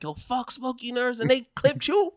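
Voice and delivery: high taunting street voice; mocking street voice. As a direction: sneer it like a rival crew. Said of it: "they was like".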